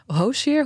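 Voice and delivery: "Hozier" said as a rising question, HO-sir; trying out "Hozier" as HO-sir, unsure; unsure pronunciation. Shown as a demonstration. Host asks friends how to say the name, questioning. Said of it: "Hoe zeg je dat eigenlijk".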